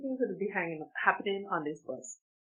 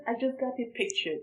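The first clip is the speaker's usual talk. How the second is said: normal talking voice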